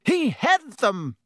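high, drawn-out boast; proud. A narrator's reading. Painted knight answering the professor, proud. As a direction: announce it proudly, slow and high. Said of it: "said Sir Cadogan proudly".

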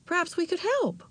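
high, sympathetic offer; interested and understanding. A narrator's reading. Aunt offering niece help, interested and understanding. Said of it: "sounded so interested and so understanding".

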